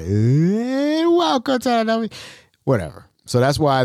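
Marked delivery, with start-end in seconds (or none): 0.0-2.1 high, slow, drawn-out welcome; mock the old intro he hated; hammed-up announcer opener; cheesy host welcome. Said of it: "I just hated that".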